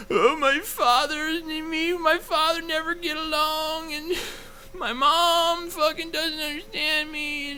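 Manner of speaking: high, slow, whiny fake crying; mock a weepy reality show contestant; hammed-up blubbering sob story; mock sobbing contestant. Mocking sappy contestant.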